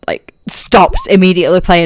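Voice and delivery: ordinary talk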